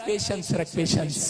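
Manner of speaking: God's gentle counsel. Retold or imitated. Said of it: "परमेश्वर कह रहा है".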